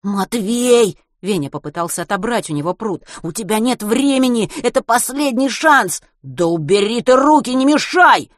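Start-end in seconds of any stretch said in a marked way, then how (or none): 0.0-1.0 pleading call. A narrator's reading. Protesting friend. 3.2-6.0 higher, louder; loud frantic insistence; desperate urging. A narrator's reading. 6.2-8.3 higher and louder snap; loud furious shout; angry bark. A narrator's reading. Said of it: "рявкнул Матвей".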